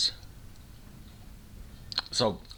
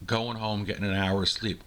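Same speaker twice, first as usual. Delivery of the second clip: normal talking voice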